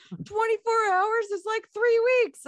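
imitating a high shrill townsperson, stretched out; squeaky background extra. Mocking a townsperson in the Jaws background, high and loud. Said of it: "that person in the background goes".

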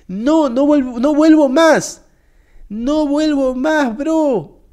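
high, drawn-out protest; mock-distressed refusal. Guest swears off visiting in mock distress. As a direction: wail the refusal, hammed-up. Refuses ever to come back.

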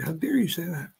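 high, incredulous scolding; indignant rebuke. Retold or imitated. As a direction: scold the rival, pitch jumping up. Retold rebuke of rival.

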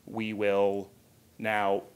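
usual speaking voice

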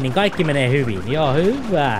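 ordinary talk

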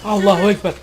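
battle cry. Real, not acted. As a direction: shout it as you charge. Charging into the fight.

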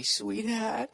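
usual speaking voice

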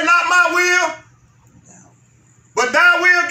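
booming scripture quote; proclaimed quote. Retold or imitated. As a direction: proclaim Jesus' words to the church. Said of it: "if Jesus said".

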